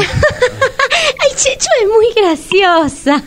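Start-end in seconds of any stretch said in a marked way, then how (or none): 0.0-1.3 high laughing ay; forced laugh. Real, not acted. Woman laughing nervously at her sister's rudeness. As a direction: cover the awkward moment with a laugh.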